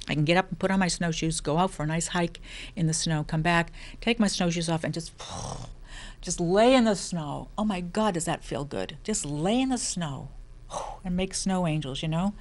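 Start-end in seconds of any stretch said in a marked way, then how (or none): 5.2-6.2 long breathy sigh of bliss; contented sigh. Real, not acted. Blissful sigh lying in snow.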